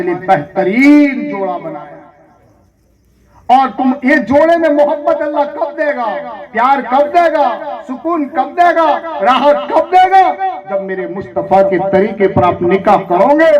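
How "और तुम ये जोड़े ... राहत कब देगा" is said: raised, ringing rhetorical questions; impassioned pulpit refrain; fervent preaching. Acted. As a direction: build each question higher for the congregation. Repeated rhetorical questions in sermon.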